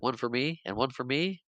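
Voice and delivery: soft, matter-of-fact little lines, lifted at the end; quiet retold child's voice; imitated kid counting. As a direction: hand them out gently, each ending upward.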